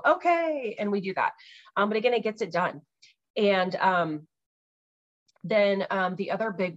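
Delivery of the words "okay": high okay. Retold or imitated. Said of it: "And I'll go".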